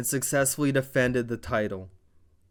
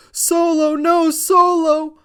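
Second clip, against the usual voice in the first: loud, very high drawn-out mock scream; Heyman screaming; 8 dB louder, slower than usual. Imitating Heyman begging Solo to stop, high screaming plea. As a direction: wail his name in panic, stretching the no. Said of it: "Heyman screaming".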